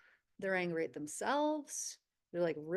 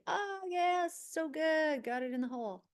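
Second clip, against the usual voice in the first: elated; 8 semitones higher than usual. Acted. Channeling a golfer who sinks the ball, elated. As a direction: savor the win, starting high and settling. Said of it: "it's showing how the person feels".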